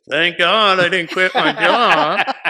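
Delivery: high, loud, drawn-out imitation of dad; dad's running joke. Dad calls out on hearing the movie is off, hammed-up holler. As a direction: holler the punchline slowly, as dad overheard. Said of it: "You hear my dad go".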